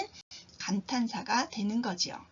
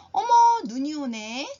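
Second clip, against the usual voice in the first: delighted surprise; 4 semitones higher, 7 dB louder than usual. Shown as a demonstration. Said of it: "이렇게 말해도 되고요".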